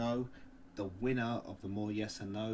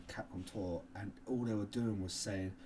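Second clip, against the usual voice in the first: ordinary talk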